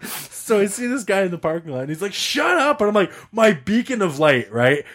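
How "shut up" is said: high-pitched mock holler; retold yell, pitched high; imitated yelling. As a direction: snap it out with pitch way up. Acting the screaming buddy.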